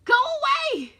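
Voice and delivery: high, forceful shout; full-force defiant cry; loud. Acted. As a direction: yell to drive the intruder off. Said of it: "she said loudly".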